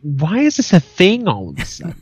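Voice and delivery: high-pitched exasperated question. Real, not acted. Co-host reacting to sovereign citizens, exasperated. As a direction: demand an explanation in disbelief.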